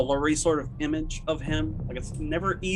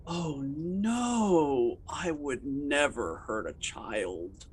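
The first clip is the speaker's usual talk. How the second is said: creepy suspect; 5 dB quieter, much slower than usual. Acted. GM voices creepy suspect John.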